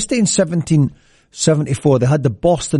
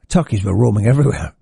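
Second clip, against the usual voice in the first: ordinary talk, nothing marked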